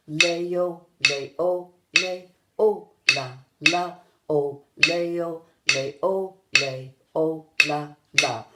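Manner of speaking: loud, measured count-along chant; slow, in rhythm. Shown as a demonstration. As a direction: say each syllable slowly on the beat. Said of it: "it slowly, but in rhythm".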